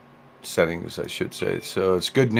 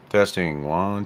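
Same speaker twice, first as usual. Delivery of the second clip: normal talking voice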